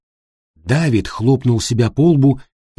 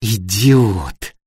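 seethed insult. A narrator's reading. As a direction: let the insult seep out slowly, without shouting. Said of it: "язвительным взглядом и процедила". (язвительно процедить, не повышая голоса)

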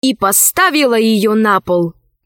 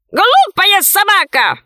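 high, slow bird jeer; scornful talking bird; 8 semitones higher, slower than usual. A narrator's reading. Left head insults the dog, high bird voice. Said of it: "сказала левая".

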